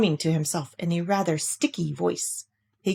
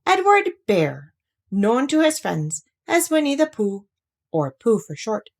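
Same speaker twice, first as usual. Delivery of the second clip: story narration; 3 semitones higher than usual. A narrator's reading. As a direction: read it like a bedtime tale. Storyteller reading Pooh aloud.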